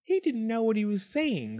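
high, light wifely voice; mild protest, pitched well up; gentle wife. A narrator's reading. Wife defends fox to husband.

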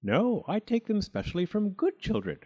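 high, gleeful contradiction. A narrator's reading. Funny Thing boasts of robbing good children, gleefully. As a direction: relish it, pitched well up. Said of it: "said the funny thing gleefully".

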